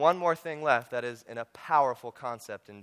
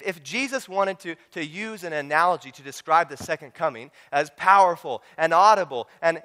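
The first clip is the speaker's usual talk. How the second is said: ordinary talk, nothing marked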